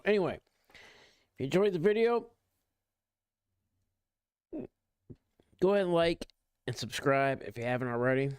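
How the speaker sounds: quick upbeat outro read; YouTuber outro. Acted. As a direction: rattle off the outro pitch. YouTube outro call to action.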